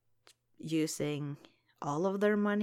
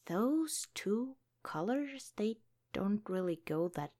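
ordinary delivery

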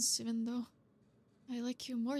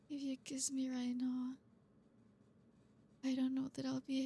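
whispering. Acted. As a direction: breathe it close to his ear. Intimate moment with partner.

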